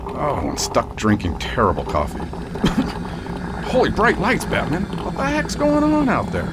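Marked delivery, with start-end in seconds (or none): 3.7-4.8 startled exclamation. Acted. Sheriff startled by lights. 5.0-6.5 high startled cry, pitched way up; alarmed. Acted. Sheriff alone at night reacting to strange lights, alarmed.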